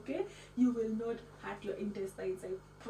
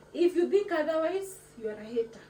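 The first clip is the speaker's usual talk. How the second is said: teasing challenge; 5 semitones higher, 7 dB louder than usual. Acted. Teasing doubters of her cooking.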